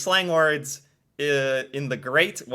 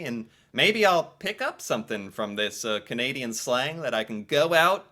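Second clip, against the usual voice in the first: ordinary delivery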